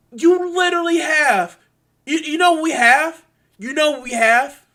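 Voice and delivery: high, loud, heated ranting; pissed off. Real, not acted. Ranter demanding viewers see the problem, pissed off. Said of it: "so fucking pissed off".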